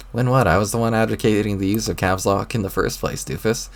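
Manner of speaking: ordinary talk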